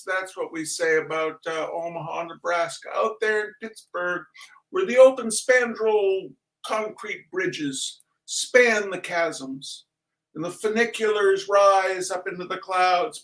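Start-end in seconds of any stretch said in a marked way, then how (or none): 3.0-13.2 hams up a booming tribute; mock grand announcer. Host to Pittsburgh viewers, mock grand announcer.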